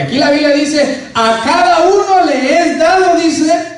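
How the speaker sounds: high, loud, slow verse shout; shouted scripture. Acted. Preacher proclaiming the verse to the congregation, shouting.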